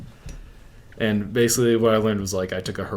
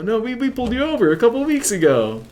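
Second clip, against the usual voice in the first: high-pitched, loud mock cop; insistent cop; 7 dB louder than usual. Cops to a denying suspect, insistent recognition. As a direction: press the point in an imitated cop voice.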